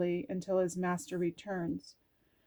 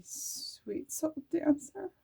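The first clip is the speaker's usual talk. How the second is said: tender; 7 semitones higher, 4 dB quieter, slower than usual. Real, not acted. Reading card's tender address.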